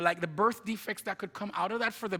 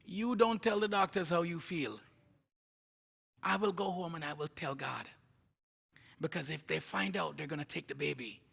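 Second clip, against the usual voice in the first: softer, measured grandma voice, laying down the plan; grandmother's firm counsel; 2 semitones lower, 6 dB quieter than usual. Retold or imitated. Grandma warning mom about the doctors, quietly firm. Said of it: "my grandma says".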